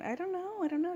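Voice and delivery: high, helpless mock cluelessness; clueless idiot, imitated. Looking like an idiot to a customer, high mock-clueless voice. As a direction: play yourself as clueless. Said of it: "I don't look like an idiot".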